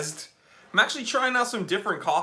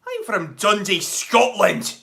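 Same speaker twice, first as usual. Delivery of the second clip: put-on reading a viewer's line in a Scottish accent, a touch louder; 3 semitones higher, 6 dB louder than usual. Streamer voicing a Scottish viewer's greeting in a Scottish accent. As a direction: put on a Scottish burr for Dundee. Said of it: "Your accents are on point".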